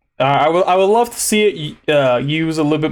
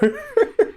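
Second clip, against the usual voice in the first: squeaky laugh. Real, not acted. Relieved the recording did not end, squeaky laugh. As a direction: burst into a tiny high cackle.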